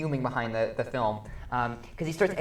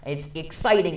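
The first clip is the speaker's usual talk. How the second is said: ordinary talk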